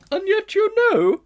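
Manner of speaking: very high, loud, slow musing elephant; a grand, ponderous lady elephant; she-elephant. A narrator's reading. She-elephant pondering the strange creature.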